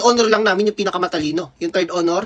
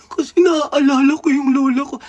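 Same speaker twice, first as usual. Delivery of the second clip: slow, higher tearful voice; imitating a crying girl; 7 semitones higher, 4 dB louder than usual. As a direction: act out a classmate's weepy reply. Crying classmate quoted.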